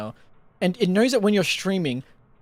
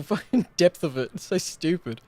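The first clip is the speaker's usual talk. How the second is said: ordinary delivery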